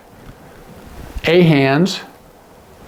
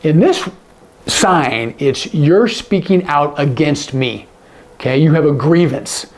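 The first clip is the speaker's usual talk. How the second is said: ordinary delivery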